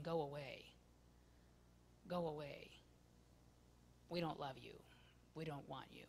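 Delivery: low, soft mutter of rejection. Retold or imitated. The crowd pushing Jesus away, low and soft. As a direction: murmur it coldly, under the breath. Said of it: "And they're saying".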